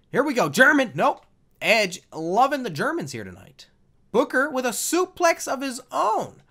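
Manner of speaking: loud, high wrestling commentary; announcer play-by-play. Pretend. Gamer commentating his own match, loud high announcer call. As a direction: call the suplexes like a ringside announcer.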